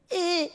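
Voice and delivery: high, held squeal; tight, high-pitched strain noise; strained squeezed squeak. Retold or imitated. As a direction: imitate a squeak from being squeezed. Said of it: "And then he'd make a sound".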